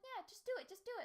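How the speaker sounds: high squeaky mock urging. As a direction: push them in a silly high voice. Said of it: "they were like".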